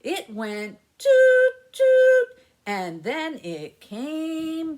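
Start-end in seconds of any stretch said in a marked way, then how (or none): 1.0-2.4 high, loud train whistle toots; piping train whistle sounds. Pretend. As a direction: toot like a little train whistle. Train whistle in children's rhyme.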